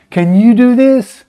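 a desperate father asking Jesus, slow and high; pleading father. Retold or imitated. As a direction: beg Jesus for help, drawn out. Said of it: "the man's".

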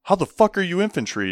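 loud mock NCO taunt; mocking sergeant. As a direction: sneer it like a sergeant ribbing a recruit. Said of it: "and he's like".

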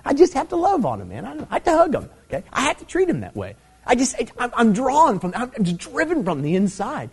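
high-pitched acted plea of someone compelled; helpless insistence. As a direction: protest that you simply can't help it. Acting out a helpless believer's answer.

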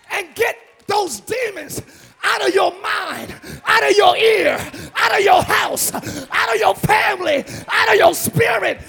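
loud, building shouted sermon; full-force preaching. Acted. Preacher to congregation casting out demons, shouting. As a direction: hammer each line at the congregation.

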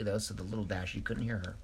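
ordinary delivery

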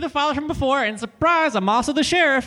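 high, bright cheery lawman; folksy sheriff voice. Acted. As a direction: play a goofy small-town sheriff. Sheriff character introduction.

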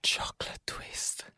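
breathy slowed whisper. Pretend. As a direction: whisper the words slowly. Whispered repeat of the pastry.

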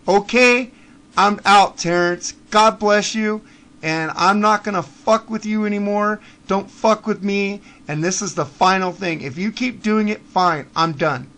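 loud, heated final scolding; angry loud rant. Real, not acted. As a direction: lay into him loudly. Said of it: "angry closing rant".

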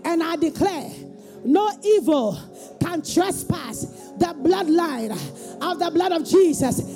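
high, slow fervent preaching; booming motivational speech. Real, not acted. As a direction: drive each declaration home. Leads congregation in declarations.

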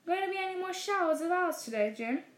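a cheerful anchor handing over to the weatherman; newsreader. Acted.

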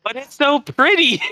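high, whining, laughing plea; whiny laugh. Real, not acted. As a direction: plead it with a giggle. Whines defending the Ram.

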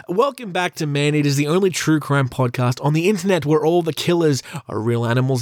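ordinary delivery

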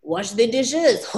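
"Wash the dishes" slowly, in a hammed-up fake French accent; mock French accent. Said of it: "And I would say".

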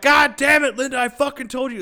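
high, loud play-fight outburst; mock angry yell. Man pretending to rage at his wife in the car, mock angry yell. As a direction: yell it like a joking scolding.

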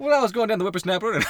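put-on high, fast old-timer voice; old prospector. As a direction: rattle it off like an old coot. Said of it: "Start talking in old time ways".

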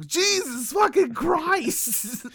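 hammed-up high slow groan of disbelief; exasperated Nietzsche impression. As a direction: stretch it slowly up high like a fed-up philosopher. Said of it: "He's like".

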